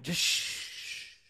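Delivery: soft whispered shh; hushing. Retold or imitated. Cutting off someone mid-story, whispered shush. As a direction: hush them like a librarian.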